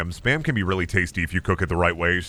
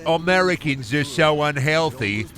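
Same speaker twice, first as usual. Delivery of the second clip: mock British accent, raised pitch; mock British person; 4 semitones higher than usual. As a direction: put on a British accent for a jab. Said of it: "British people be like".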